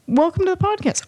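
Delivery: high pretend host welcome; podcast host greeting. Host pretends to welcome guest Bob, high greeting voice.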